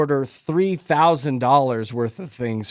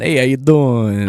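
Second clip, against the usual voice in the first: slow Long Island drawl; Long Island accent; 2 semitones lower than usual. Retold or imitated. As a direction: lean into a Long Island swagger. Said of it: "the Long Island accent".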